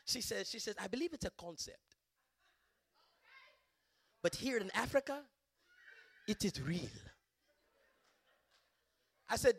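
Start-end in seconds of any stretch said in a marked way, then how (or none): 0.8-6.9 imitated African accent, measured phrasing; deliberate African-accented speech with pauses. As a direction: retell it in a woman's African accent. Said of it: "African accent".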